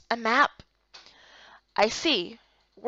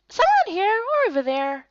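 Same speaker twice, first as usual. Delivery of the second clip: high, light little-creature voice; small companion voice; 8 semitones higher than usual. Acted. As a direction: play the tiny friend, perky.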